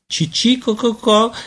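slow, very high-pitched cooing; mock-coo at a baby, slowly; teasing baby talk. A narrator's reading. (vezzeggiativi canzonatori, lenti e acuti)

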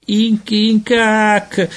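put-on drawn-out, high baby squeal; urlo da neonato. A narrator's reading. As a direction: squeal babble slowly and high. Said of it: "si sentì urlare". (strillo acuto e lento da bebè)

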